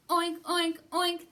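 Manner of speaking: high, loud pig oinks; hammed-up piglet shout. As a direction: squeal it like an angry pig. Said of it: "that pig did shout".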